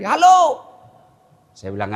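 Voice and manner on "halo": shouting into the phone. Retold or imitated. Haji shouting into wrong end.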